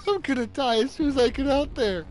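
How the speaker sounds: high, pleading lament; despairing wail. Real, not acted. Gamer sure he will die, high despairing wail. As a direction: cry out as if doomed.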